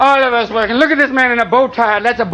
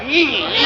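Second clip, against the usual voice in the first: squeaky ee; 4 dB quieter than usual. Retold or imitated. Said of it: "they'd pop a wheelie, like".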